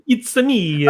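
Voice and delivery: high, hammed-up Italian game-hero voice; Super Mario. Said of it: "They do the Super Mario".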